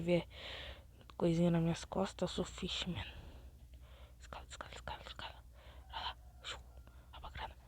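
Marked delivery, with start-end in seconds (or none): 4.2-6.6 whispered, urgent and hushed; sneaky whisper. Real, not acted. Gamer sneaking up a wall, whispering urgently. Sneaking while climbing in game. 7.1-7.5 hushed thief's whisper; sneaky whisper. Real, not acted. Gamer whispering to viewers while stealing money.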